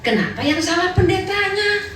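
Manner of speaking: mock indignant. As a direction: play the touchy member blaming the pastor. Mocking offended churchgoer. (nada tinggi pura-pura tersinggung)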